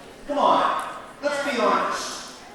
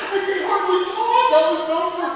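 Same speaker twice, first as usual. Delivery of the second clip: put-on high, loud kid voice; hammed-up squeaky complaint; upset kid; 10 semitones higher, 7 dB louder than usual. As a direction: strain it high like a worked-up kid. Imagined upset kid going home, high voice.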